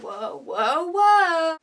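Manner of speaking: losing balance; startled whoa. Real, not acted. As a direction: catch yourself wobbling. Losing balance mid-pose.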